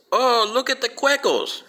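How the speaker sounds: high, slow mock-excited cry; excited exclamation. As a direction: imitate an excited tourist spotting something. Voicing her pointing out birds.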